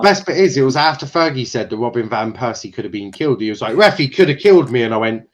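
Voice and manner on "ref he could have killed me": a player's loud put-on wail at the ref; hammed-up footballer crying to the referee; mock protest. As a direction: overact the complaint, loud and high.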